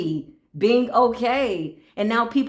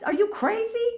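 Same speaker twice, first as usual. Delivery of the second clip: much higher, incredulous; mock outrage, higher and higher; indignant disbelief; 10 semitones higher than usual. Indignant rant about energy.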